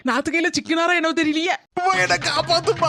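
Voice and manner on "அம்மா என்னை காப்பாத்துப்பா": acted cry for help; tearful, hammed-up plea; wailing plea. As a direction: wail for mother to save you. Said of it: "இப்படி போய் கதறி கதறி அழுதுட்டு".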